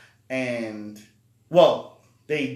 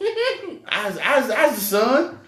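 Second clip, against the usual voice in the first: imitating girl; 12 semitones higher, 7 dB louder, slower than usual. Girl faking being the son.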